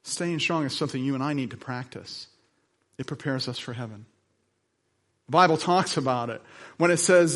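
ordinary talk, nothing marked

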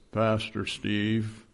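slow, drawn-out imitation of Nace. Pastor imitating a church member calling his name, slow drawl. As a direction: stretch it out like Nace does. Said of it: "in that Nace voice".

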